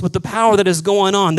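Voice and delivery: ordinary talk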